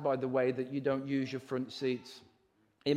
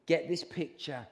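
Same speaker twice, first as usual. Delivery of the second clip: ordinary talk, nothing marked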